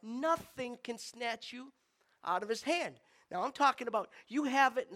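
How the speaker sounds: loud, high, urgent sermon voice; fervent preaching. Acted. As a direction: preach it with rising fire. Preaching at full force.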